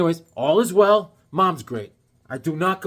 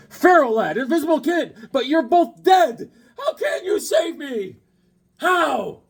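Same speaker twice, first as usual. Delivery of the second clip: higher, slower, desperate plea; dramatic comic hero; 10 semitones higher than usual. Acted. Host reading a comic cover's line as a tearful hero. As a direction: ham up the doomed hero's cry.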